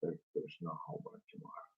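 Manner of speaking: whispered echo of the teacher; student repeating. Shown as a demonstration. Student repeats what the teacher said, quick whisper. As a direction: repeat it quickly under your breath, whispered. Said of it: "You go".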